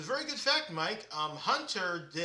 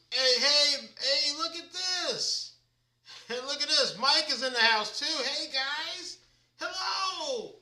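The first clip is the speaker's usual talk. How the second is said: much higher and louder, calling hey; excited greeting; 8 semitones higher, 5 dB louder than usual. Real, not acted. Host welcoming Mike into the stream, thrilled and loud. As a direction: hail a friend who just showed up.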